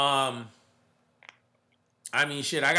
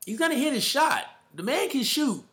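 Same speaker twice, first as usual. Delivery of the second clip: pitched way up, hyped about the shooter; excited emphasis; 9 semitones higher than usual. Real, not acted. Hyped podcaster insisting Murray will hit his shot, excited. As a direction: repeat it, higher and more fired up. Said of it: "and he's gonna hit his shot".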